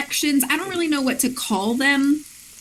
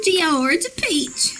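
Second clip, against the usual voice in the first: slow, higher drawn out; slow drawl; 6 semitones higher, slower than usual. Pretend. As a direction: stretch it out slowly. Repeats drawl, slow high.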